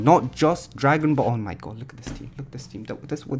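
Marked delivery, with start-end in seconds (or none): none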